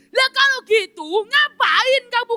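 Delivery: high, indignant outburst; angry. Acted. Man angrily asks the angel why it came, high indignant. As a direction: snap at the useless angel. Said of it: "Om Kristen ini mulai marah".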